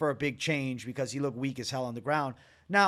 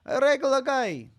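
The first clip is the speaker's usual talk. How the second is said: Merab impression; 9 semitones higher, 3 dB louder than usual. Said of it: "Worst Merab impression yet from me".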